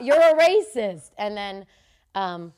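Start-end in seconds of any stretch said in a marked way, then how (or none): 0.0-1.1 high, very loud, stretched-out shout; acted-out yell across the room; retold shouted accusation. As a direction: belt out the accusation, drawn out. Retold repeated accusation in quarrel.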